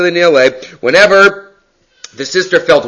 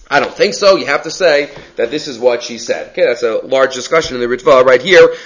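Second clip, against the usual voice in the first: ordinary delivery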